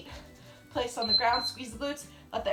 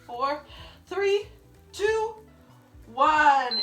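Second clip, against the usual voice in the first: rising, louder countdown; countdown cheer; 3 semitones higher, 5 dB louder, much slower than usual. Real, not acted. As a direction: push them through the last reps. Counting down a set.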